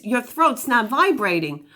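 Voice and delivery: normal talking voice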